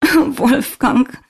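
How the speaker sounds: high, loud, tearful wail; sobbing. A narrator's reading. Svenja alone in her car after bad news, sobbing. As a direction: cry out the name through heavy sobs. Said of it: "schluchzte sie".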